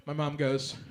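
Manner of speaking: usual speaking voice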